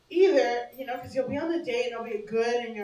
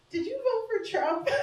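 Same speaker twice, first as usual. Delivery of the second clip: nervous laughing question; 7 semitones higher, 4 dB quieter than usual. Retold or imitated. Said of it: "then you're like".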